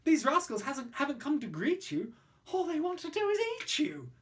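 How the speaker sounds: high, urgent, stumbling plea; nervous pleading. Acted. Child begs the toad to flee, high and anxious. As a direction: hurry to get away before they eat you. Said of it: "I cried".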